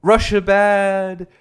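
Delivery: slow, solemn parody of a preachy comic; mock the applause-bait; drawn-out mock solemnity; mock earnest comic. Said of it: "being like".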